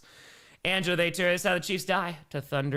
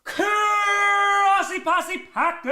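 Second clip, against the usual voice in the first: high, slow, stretched-out shout; drawn-out hype yell; hype intro shout; 5 dB louder, much slower than usual. Acted. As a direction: belt it like a show opener. Stream intro call-out.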